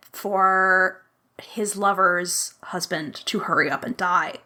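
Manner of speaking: usual speaking voice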